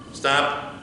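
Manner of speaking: ordinary talk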